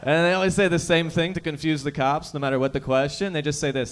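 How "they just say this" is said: usual speaking voice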